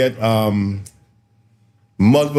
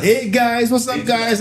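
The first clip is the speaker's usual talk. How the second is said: much higher, mock-American "hey guys"; put-on American accent; 10 semitones higher than usual. Mocking fellas who yank, high fake American accent. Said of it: "just speak normal".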